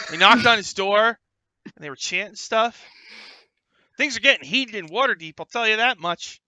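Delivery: put-on high, loud eager young reporter; high excited young reporter voice. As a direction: sell it like a keen cub reporter. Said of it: "Jim Lee says".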